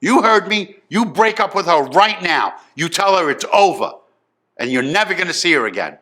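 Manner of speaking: loud, high command easing at the end; angry father. Retold or imitated. Father insists the girlfriend is finished, angry and forceful. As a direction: play a furious dad who allows no argument. Retold father repeats his order.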